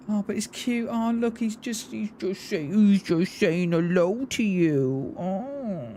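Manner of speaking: put-on high coo, slowing into baby talk; hammed-up doting dog owner; mock pet voice. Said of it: "you like my pet voice".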